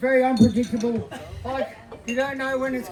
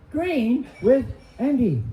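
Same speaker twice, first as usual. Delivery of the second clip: normal talking voice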